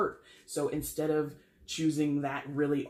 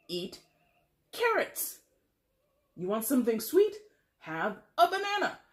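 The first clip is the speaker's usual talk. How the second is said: mock scolding; 6 semitones higher than usual. Scolding about snacks.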